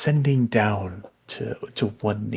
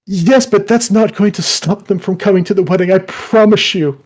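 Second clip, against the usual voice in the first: higher, louder eager reassurance; eager suitor; 6 semitones higher, 6 dB louder than usual. Acted. Suitor reassuring the woman about the wedding. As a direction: promise it brightly.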